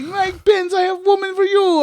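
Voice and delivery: high, put-on foreign voice; a hammed-up Borat pitch; Borat impression. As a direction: Borat calling out to Pence. Voicing Borat at rally.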